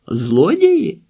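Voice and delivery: high-pitched questioning cry; startled shout. A narrator's reading. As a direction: cry out «Злодії?» as if misheard. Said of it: "закричали водночас Лука і Фіона".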